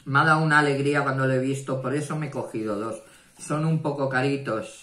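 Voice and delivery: fast, loud burst of delight; gushing with real joy, words tumbling out; excited. Real, not acted. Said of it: "estoy enamorada". (entusiasmada, rápido y fuerte)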